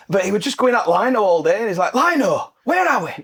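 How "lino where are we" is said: high-pitched shout at the linesman; imitated manager hollering; mock touchline yell. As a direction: call out across the pitch, much higher.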